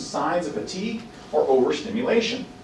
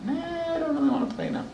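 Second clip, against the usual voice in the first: higher, softer whiny refusal; mock reluctant child; 10 semitones higher, 9 dB quieter, much faster than usual. Child turning down the parent's late invitation, mock reluctance. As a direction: play a kid backing out, half-hearted.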